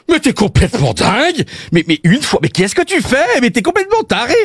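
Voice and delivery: put-on high-pitched scolding with a slight Belgian accent; mock outraged yell. Wife finding her flat wrecked by her husband, mock outraged yell. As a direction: shriek it as a comic appalled wife. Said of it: "elle hurle en voyant le carnage".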